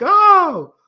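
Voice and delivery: high, loud drawn-out "oh"; imitated shout. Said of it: "and shouts like".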